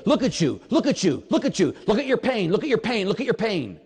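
insistent, rapid-fire taunting repetition; needling devil. Retold or imitated. Devil poking at a guilt-ridden man, needling accusation. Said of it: "he kept poking and poking and poking".

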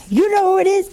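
startled friend asking; surprised question. Retold or imitated. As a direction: voice jumping up in disbelief. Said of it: "I said".

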